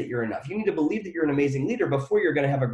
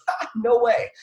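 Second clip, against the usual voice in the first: a quick mocking scoff, pitched up; scoffing brain voice; 7 semitones higher, slower than usual. The brain scoffing at a debt-free goal, mocking. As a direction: laugh off the goal in one dismissive beat. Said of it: "your brain goes".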